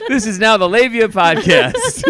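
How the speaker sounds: giggling through the words; cracking up as she announces; laughing. Real, not acted.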